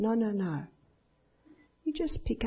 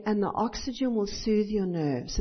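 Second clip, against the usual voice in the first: ordinary talk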